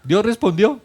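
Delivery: laughing. Real, not acted. Pastor tells the congregation God answered, laughing. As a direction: let the laugh carry the punchline.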